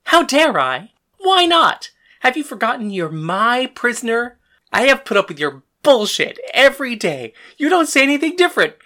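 high, loud, incredulous fury; angry retort. Acted. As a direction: throw it back at him, shouting. Acted shouting quarrel with father.

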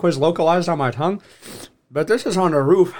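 usual speaking voice

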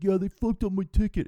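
high, loud complaining voice; whiny entitled guy. Retold or imitated. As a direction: whine like a spoiled passenger. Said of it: "and he's like".

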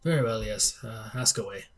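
softly voiced bereaved father; subdued elderly gentleman, lightly acted; grieving old patriarch. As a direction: quietly give leave to be questioned.

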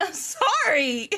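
high, slow, drawn-out sorry; mock apology. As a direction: stretch out a playful apology up high.

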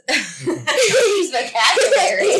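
helpless laughter through the words, high and loud; laughing hard. Real, not acted. Mom tickled by kid's complaint about vocabulary, laughing hard. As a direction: crack up while repeating it. Amused at child's big-word complaint.

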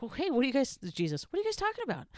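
a friendly stranger joining the walk; Jesus asking. Acted. Jesus voiced asking the disciples.

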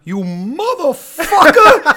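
imitating dad's high, loud screamed curse; furious shout. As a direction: yell it like an angry dad whose kid just farted in the car. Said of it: "my father would just go".

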